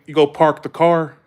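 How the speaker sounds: Southern "park the car" with R's sounded; R-full Southern accent. Shown as a demonstration. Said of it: "say it in the South".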